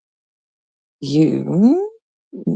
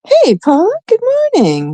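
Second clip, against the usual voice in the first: much higher, bright sing-song hello; sing-song greeting. Real, not acted. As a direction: call out a cheerful high greeting. Greeting chat member on stream.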